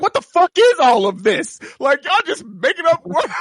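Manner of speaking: high, loud, laughing disbelief; baffled outburst. Retold or imitated. Gamer venting at made-up fantasy words, loud laughing disbelief. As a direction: throw up your hands at the nonsense words, laughing. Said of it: "And I'm like".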